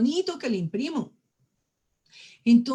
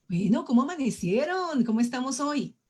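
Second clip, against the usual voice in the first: higher, lilting morning greeting; cheerful, good-humored; 4 semitones higher than usual. Shown as a demonstration. Speaker demonstrating a good morning to the group, cheerful. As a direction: greet them as if up in a great mood. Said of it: "se levantó de buen genio".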